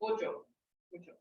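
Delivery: bujo with the oo sound, said softly; bujo, the right way, softly; correct pronunciation. Shown as a demonstration. Teacher gives the correct bujo to the class, softly. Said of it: "but it's".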